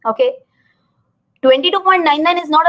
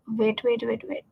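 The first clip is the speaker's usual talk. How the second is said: muttering to herself; 2 semitones lower, 10 dB quieter than usual. Real, not acted. Muttering while searching screen.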